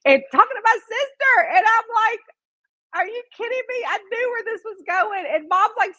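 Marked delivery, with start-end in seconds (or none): 3.0-3.8 high incredulous question; retold disbelief. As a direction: replay it as scandalized daughter. Retelling disbelief at mother.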